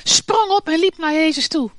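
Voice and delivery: normal talking voice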